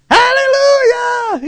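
long, very high hallelujah shout; joyful shout. Retold or imitated. Healed mother bursting into church, shouting slow and very high. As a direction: stretch it slowly, full voice.

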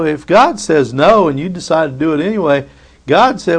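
ordinary talk

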